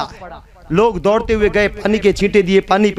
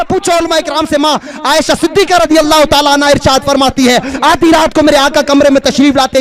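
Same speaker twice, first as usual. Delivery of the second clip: raised, ringing sermon delivery; full-force preaching; 7 semitones higher, 5 dB louder than usual. Acted. Preacher urging them to ask the scholars, full-force preaching. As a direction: challenge the crowd at the top of the voice.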